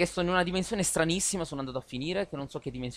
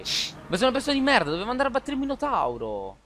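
explosive italian cursing; 5 semitones higher, 5 dB louder than usual. Real, not acted. Curses himself after dying.

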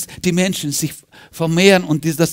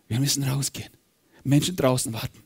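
ordinary delivery

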